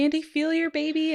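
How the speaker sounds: imitating an excited midwife urging her on. Midwife urging the mother to touch her baby, excited. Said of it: "she was so excited".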